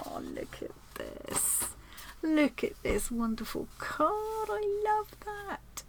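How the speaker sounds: a hushed, high-pitched gush of delight at a lovely card; delighted awe. Real, not acted.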